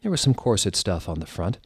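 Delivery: usual speaking voice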